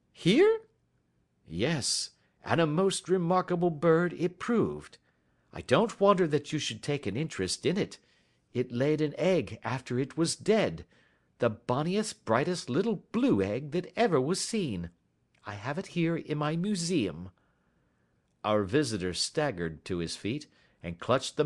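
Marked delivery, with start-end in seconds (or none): 0.1-0.6 very high, loud question; astonished squeak. Acted. Ryder astonished at the answer. 1.5-17.3 higher, teasing detective; Sherlock Holmes. Acted. Voices Holmes.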